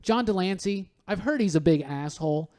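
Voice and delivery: usual speaking voice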